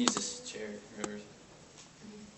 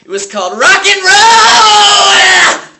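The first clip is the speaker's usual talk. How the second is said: high, drawn-out rock scream; Dewey Finn; 19 dB louder, slower than usual. Acted. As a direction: explode into a very loud, held yell on the last word. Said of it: "lash out his rage at the small private school".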